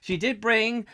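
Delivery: normal talking voice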